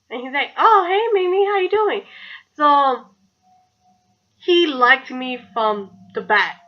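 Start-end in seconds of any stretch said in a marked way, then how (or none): 0.6-2.0 high-pitched, louder mock-friendly hello; upbeat greeting. Guy greeting the girl he just met, upbeat high greeting. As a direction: imitate an eager hello, pitched well up.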